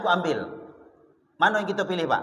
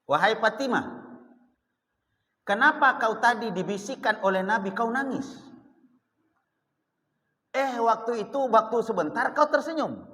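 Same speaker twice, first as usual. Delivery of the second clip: Aisyah asking; 6 semitones higher than usual. Retold or imitated. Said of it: "Lalu ditanya".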